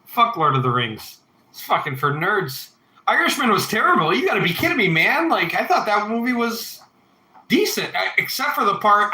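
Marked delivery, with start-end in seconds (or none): 3.1-9.1 higher and quicker, disbelieving; you gotta be kidding me, pitched up; incredulous outburst. Real, not acted. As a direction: fire back in disbelief at the chat.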